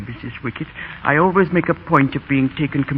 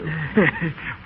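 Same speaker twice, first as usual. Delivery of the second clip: soft chuckle; 5 dB quieter than usual. Acted. Amused by the boys' prank.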